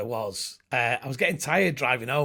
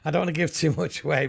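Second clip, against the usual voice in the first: normal talking voice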